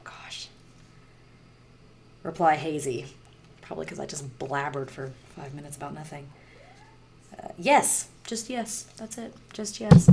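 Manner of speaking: normal talking voice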